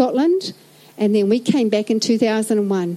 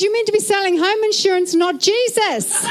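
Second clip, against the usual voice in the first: exasperated manager; 7 semitones higher than usual. Retold or imitated. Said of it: "He goes".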